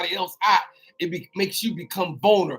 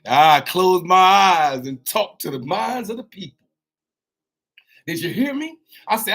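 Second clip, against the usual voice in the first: ordinary delivery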